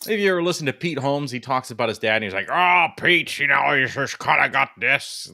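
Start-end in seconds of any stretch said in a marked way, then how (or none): 2.5-5.3 loud, blustery put-on father voice; exaggerated dad impression. Comedian's dad talking to his son, exaggerated. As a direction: overdo a comic dad. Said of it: "exaggerated thing".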